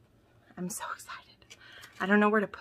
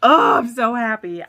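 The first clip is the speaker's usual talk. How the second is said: overjoyed; 4 semitones higher, 9 dB louder than usual. Real, not acted. Overjoyed at gifted duet.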